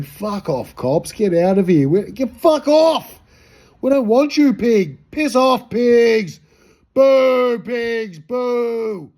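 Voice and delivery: high, loud jeering at the cops; jeering shout. Retold or imitated. Young bloke jeering the cops from the park, loud and high. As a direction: yell abuse at the police car.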